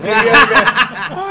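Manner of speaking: normal talking voice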